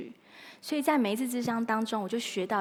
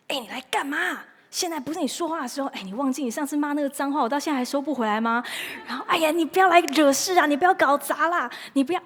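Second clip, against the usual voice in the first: higher, irritated nagging, getting louder toward the end; annoyed scolding; 4 semitones higher, 5 dB louder than usual. Acted. Preacher scolding her own anger, mock exasperated. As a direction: shoo the unwanted guest away, exasperated. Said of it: "你不要一直告诉他说".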